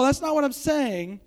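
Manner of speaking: ordinary delivery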